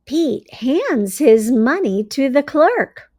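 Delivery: bright, expressive storybook read-aloud; reading to children. A narrator's reading. Teacher reading a story to her class, expressive read-aloud. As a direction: read it like a teacher sharing a picture book.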